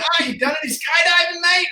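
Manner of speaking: high, mock Kiwi shout without much volume; rough New Zealand accent. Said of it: "my accent was off".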